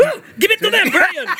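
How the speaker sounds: egging Brian on with a hammed-up yell; mock cheer. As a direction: cheer him on like a rowdy fan.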